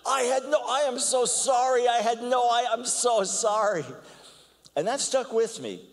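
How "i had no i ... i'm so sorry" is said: high, louder, gushing apology; embarrassed man apologizes to the speaker; flustered apology. Retold or imitated. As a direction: fall over yourself apologizing. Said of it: "He goes".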